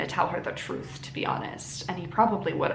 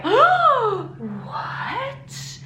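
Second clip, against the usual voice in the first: shocked; 13 semitones higher than usual. Retold or imitated. Everybody shocked by the news.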